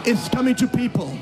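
loud, high-pitched fiery preaching; preaching at full force. Acted. Pastor proclaiming the Lord's promise to the congregation, loud and fervent. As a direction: proclaim the promise like thunder over the crowd.

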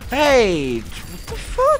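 very high, slow shocked cry; high indignant shriek; outraged yelp. Real, not acted. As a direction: caught off guard. Startled outrage.

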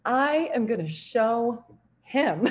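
slow, laughing vow to prove him wrong; half-laughing, slow competitive resolve; laughing determination. Retold or imitated. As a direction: chuckle through a defiant promise. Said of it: "repeated with laughing emphasis".

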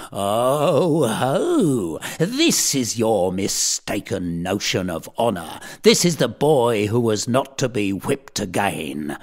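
put-on a stern tutor crowing over a caught boy; triumphant. A narrator's reading. Thwackum gloats over Tom before Allworthy, loud and triumphant. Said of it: "he cried out in triumph".

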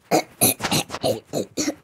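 harsh choking cough; coughing fit. Real, not acted. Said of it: "but I will choke".

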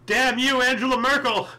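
a playful mock outburst; mock curse. Blaming the politician for Europe's troubles, mock angry shout.

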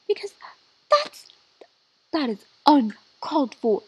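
loud scolding mom voice; scolding parent. Pretend. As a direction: tell her off, loud and sassy. Scolding her sim like parent.

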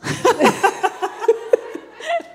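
loud, high, ringing laugh; burst of laughter. Real, not acted. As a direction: laugh out loud at your own boldness. Guest laughs at last-minute ticket. (éclat de rire aigu et sonore)

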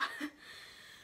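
a quiet breathy laugh while breaking off; real little laugh through emotion; soft embarrassed laugh. Real, not acted. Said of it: "oh, I gotta stop".

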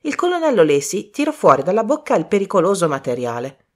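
ordinary delivery

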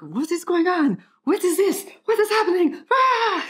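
high, loud panicked shouts in a bad French accent; terrible French accent. Caveman Pierre having a panic attack and tantrum, terrible French accent. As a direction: freak out with a hammed-up French accent, ending in a yell. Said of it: "This is my terrible French accent".

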